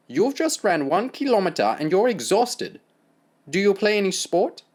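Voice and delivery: German doctor mocking the boy; German accent.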